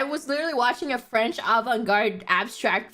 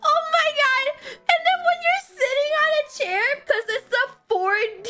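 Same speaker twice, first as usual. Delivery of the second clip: laughing; 12 semitones higher, faster than usual. Real, not acted. Laughing recalling the chair.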